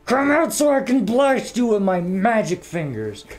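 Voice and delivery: high, loud put-on monster taunt; hammed-up boss villain. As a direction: play a raging game boss, over the top. Voices the angry boss taunting.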